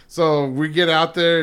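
ordinary delivery

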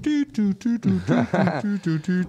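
high sung-out phone beeps; imitated dial tones. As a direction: mimic keypad tones in high little toots. Imitates phone keys being dialled.

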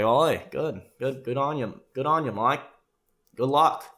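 slap-on-the-back Australian encouragement; Australian dad. Retold or imitated. Dad cheering son on before the date, upbeat Australian accent. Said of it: "his dad is just an Australian guy".

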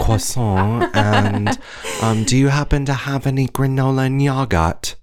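mock British, hesitant ordering; British accent going in and out; half British accent. Imitating customer at coffee shop, patchy British accent. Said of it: "losing reception on her accent".

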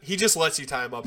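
normal talking voice

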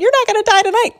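high, loud panicked retort; hammed-up shrieky insistence; frantic reassurance. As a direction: squeal it back in acted panic. Frantic reply to terrified friend.

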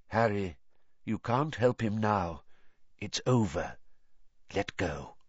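gentle calm. A narrator's reading. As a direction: coax him to let go. Dumbledore gently calms Harry.

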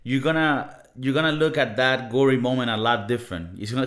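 usual speaking voice

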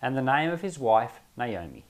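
measured, formal reading of scripture. Acted. Reading the book of Ruth to the group, measured scripture reading. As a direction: read the Bible passage aloud with care. Reads Ruth aloud before teaching.

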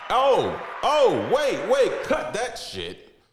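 high, loud, sudden stop; sudden outburst. Real, not acted. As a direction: blurt it out, suddenly remembering. Suddenly remembers mid-intro.

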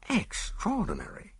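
high, hushed astonishment; doctor's amazed exclamation; amazed cry. A narrator's reading. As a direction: exclaim in startled disbelief. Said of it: "cried Ames".

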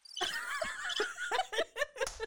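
shrieking burst of laughter; high squealing laugh. Real, not acted.

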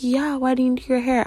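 high, airy sister voice; mock clueless relative; mimicking sister. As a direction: play her as clueless. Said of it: "She's like".

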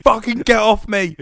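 high, loud panicked scream. Acted. Co-host plays the hat put on Ron, high screaming. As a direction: scream it as a hat desperate to escape. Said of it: "screaming like".

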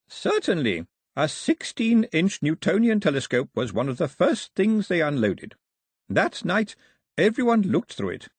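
a hint of an old man, chatty and fond; lightly voiced old man. Old man chatting to two children, lightly voiced.

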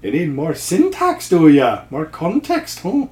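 put-on a would-be Scottish accent that comes out Irish; bad Irish accent. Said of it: "sounding like a very bad Irish accent".

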